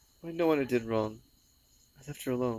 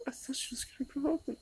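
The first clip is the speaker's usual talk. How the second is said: high, cracking sobbing voice; words pushed out through real tears; voice breaking into sobs; 10 semitones higher than usual. Real, not acted. Crying over her dead pet.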